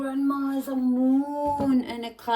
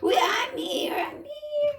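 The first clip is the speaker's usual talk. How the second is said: high squeaky toy voice; 12 semitones higher than usual. Pretend. Answering in toy voice.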